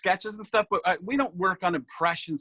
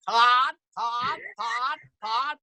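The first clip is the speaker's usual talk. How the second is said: "Todd" repeated higher, each rising; stressing Todd; 6 semitones higher, much slower than usual. Acted. Impressionist showing his Kuiper take, higher rising "Todd". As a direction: lean hard on every "Todd". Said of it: "I'm more the guy who stresses Todd".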